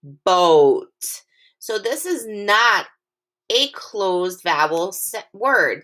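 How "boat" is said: "Boat" with a long O that says its name; "Boat", long O stretched. Shown as a demonstration. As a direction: hold the O in "Boat". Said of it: "makes O say its own name".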